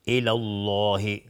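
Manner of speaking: الله with a thick, dark L, slowly drawn out; heavy L. Shown as a demonstration. Teacher demonstrating after fatha, heavy L in الله.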